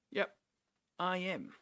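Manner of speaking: normal talking voice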